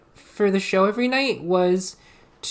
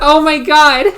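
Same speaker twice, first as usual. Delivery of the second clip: loud, higher-pitched exclamation; shocked outburst; 7 semitones higher, 11 dB louder than usual. Real, not acted. Streamer reacts to the game's flirting, loud shocked outburst. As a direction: burst out in real shock.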